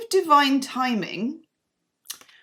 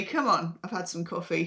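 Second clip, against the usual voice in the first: ordinary talk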